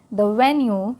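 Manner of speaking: slow, careful read-aloud for learners; English read-aloud for Hindi-speaking students, slow. Shown as a demonstration. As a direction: read it slowly so learners can follow along.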